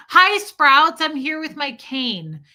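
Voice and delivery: loud, high mock greeting; hammed-up mom calling out her cane; mock announcement. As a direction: announce it to the whole store.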